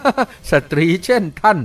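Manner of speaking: slow, mocking reply after a laugh; slow words on the tail of laughter; laughing retort. A narrator's reading. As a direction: draw it out, coming off a laugh. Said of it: "หัวเราะดังๆออกมาบ้าง กล่าวว่า".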